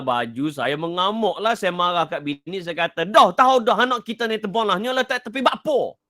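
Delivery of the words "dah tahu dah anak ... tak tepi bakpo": a father snapping at his wife in Kelantanese, higher; mock-angry Kelantan outburst; angry Kelantan dialect. Father scolds wife over spilled tea, mock-angry Kelantanese. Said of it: "tubik pasal Kelantan. Bila nak marah".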